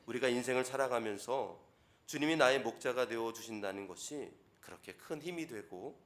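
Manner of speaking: normal talking voice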